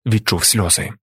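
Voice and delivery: ordinary delivery, nothing marked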